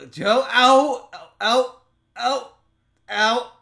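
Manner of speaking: high, loud yelps of pain; pained cries. Real, not acted. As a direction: cry out in pain. Arm being squeezed.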